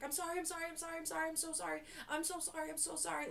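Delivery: high, rapid-fire imitated pleading; frantic apologizing. New stylist begs angry client for forgiveness, frantic high pleading. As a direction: apologize over and over without pausing. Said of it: "I started crying".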